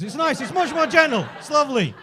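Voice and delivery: high-pitched, sarcastically approving; mock reassurance. Comedian sarcastically defending espresso-machine gentrification to the audience, mock reassurance.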